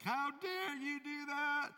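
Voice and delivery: high, slow, shocked yell in a put-on voice; outraged yell. King after losing his thumb, yelling in outrage. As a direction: howl it out like a wounded king.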